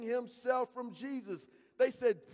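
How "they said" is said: usual speaking voice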